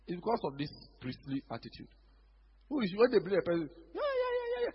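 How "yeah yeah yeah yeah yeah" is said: high, airy yeah-yeahs; lightly mock the guests' empty cheering. Preacher mocking wedding guests in a high voice.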